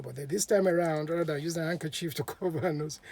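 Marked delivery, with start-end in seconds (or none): none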